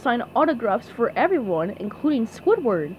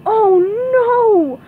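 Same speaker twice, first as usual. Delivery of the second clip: loud, high "oh no" wail; dismayed cry; 10 semitones higher, 9 dB louder than usual. A narrator's reading. Character sees the waiting crowd, crying out in dismay. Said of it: "he cried".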